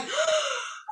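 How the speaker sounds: a sharp, high gasp of delight. Retold or imitated. Reliving her Bible study discovery, a delighted gasp. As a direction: gasp as the discovery hits you. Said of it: "I'm like".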